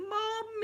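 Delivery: high, drawn-out wail of "Mum"; imitated crying wail. Retelling the coffin scene, imitated crying wail. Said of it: "she's crying her eyes out and going".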